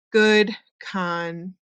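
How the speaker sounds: "content" slowly split, first syllable CON stressed; "content" as the noun, CON-tent. Shown as a demonstration. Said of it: "listen for how the stress".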